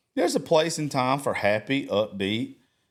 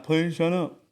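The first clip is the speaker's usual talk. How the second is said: kindergarten teacher voice; 5 semitones higher than usual. Retold or imitated. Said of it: "I do this kindergarten voice".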